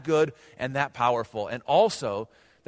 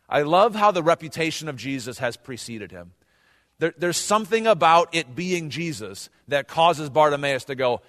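ordinary talk

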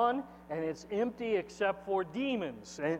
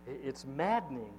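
usual speaking voice

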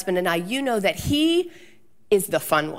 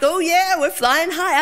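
higher and louder, hamming it up; jokey hype; 7 semitones higher, 4 dB louder than usual. Retold or imitated. Wife joking to the video guy, hyped and silly. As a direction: play the goofy joker for the camera. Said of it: "I've got all these jokes".

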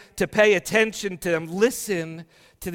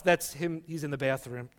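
usual speaking voice

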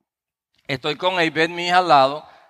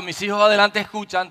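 normal talking voice

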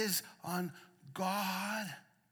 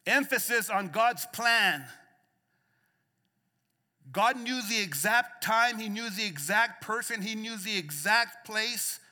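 rising preacher's cadence, louder on the peaks; preaching at full force; 4 semitones higher, 8 dB louder than usual. Real, not acted. Pastor preaching hope to the church, full force. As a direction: preach it out to the congregation.

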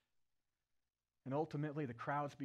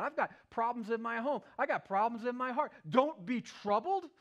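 exasperated, building louder and higher to the end; incredulous complaint; 9 semitones higher, 9 dB louder than usual. Pretend. Troubled believer pushes back on scripture, building to exasperation. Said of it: "we look at our lives and we say".

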